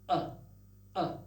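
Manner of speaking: sharp glottal catch on uh; short throat-stop bursts; glottal stop demo. Shown as a demonstration. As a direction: close the throat, pop it open. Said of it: "like this".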